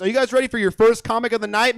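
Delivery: loud, high crowd hype; hype host. Real, not acted. Host firing up the crowd, loud and high. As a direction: pump the crowd up, shouting. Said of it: "make it a little louder".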